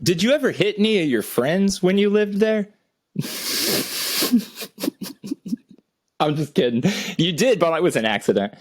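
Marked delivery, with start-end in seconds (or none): 3.1-5.5 silent wheeze laugh. Real, not acted. Teasing question about punching.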